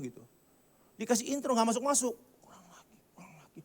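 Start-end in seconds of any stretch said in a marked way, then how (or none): 2.4-3.6 whispered aside. Retold or imitated. Leader whispers to the musicians that the intro isn't enough, whispered. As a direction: whisper it under your breath.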